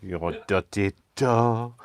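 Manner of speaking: put-on slow, saucy French accent; hammed-up French innuendo. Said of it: "You wanna do your French accent".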